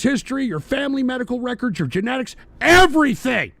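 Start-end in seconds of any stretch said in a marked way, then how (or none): 2.6-3.5 loud, high burst capping a list; emphatic shout. Real, not acted. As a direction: punch it out in alarm. Climax of an alarmed list.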